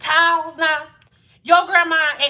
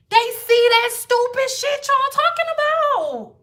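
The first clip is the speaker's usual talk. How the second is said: exasperated squeal; 11 semitones higher than usual. Real, not acted. Exasperated, viewers are watching.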